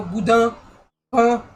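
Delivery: northern French "boudin" and "pain", short with no ng; closed-mouth northern French vowels; northern French accent. Shown as a demonstration. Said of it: "in north of France, they go".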